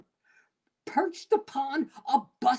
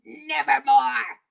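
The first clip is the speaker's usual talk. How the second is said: raven's reply; 4 dB louder than usual. A narrator's reading. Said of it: "Quoth the raven".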